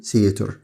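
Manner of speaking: "theater" said as "seeter", the TH turned into an S sound. Shown as a demonstration. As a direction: start "theater" with a plain S instead of TH. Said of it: "ال تي اتش بتنطقها زي صوت الس". ("theater" بنطق ال TH زي صوت الس)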